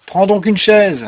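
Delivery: high, loud welcoming call; hearty host. Acted. New friend inviting Rip to sit, hearty host. Dwarf hospitably offers a seat.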